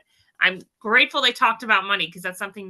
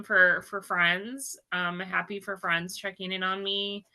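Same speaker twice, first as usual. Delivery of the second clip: ordinary talk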